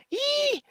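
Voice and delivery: very high delighted "Yee!"; happy squeal. Real, not acted. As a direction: let out a tiny squeal of joy.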